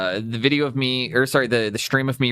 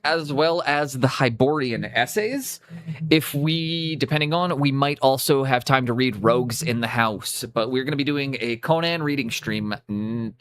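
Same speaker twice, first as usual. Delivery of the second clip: ordinary talk, nothing marked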